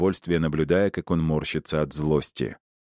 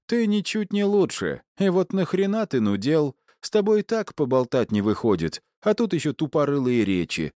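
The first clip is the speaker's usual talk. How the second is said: mocking thug, pitched up; high mocking thug voice; 10 semitones higher than usual. A narrator's reading. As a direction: sneer at the samurai, high and fast. Boltun mocking samurai's speeches.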